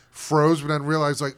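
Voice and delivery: ordinary talk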